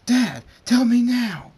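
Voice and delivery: put-on high, loud, slow child's demand; a kid yelling for an answer; child shouting at her dad. Luna bursts in demanding.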